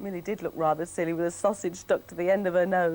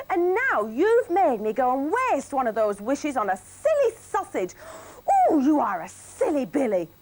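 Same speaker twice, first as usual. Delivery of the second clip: higher, louder cross telling-off; scolding wife; 8 semitones higher, 4 dB louder than usual. Acted. Wife Millie scolding her husband, high and cross. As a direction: give silly Billy a proper telling-off.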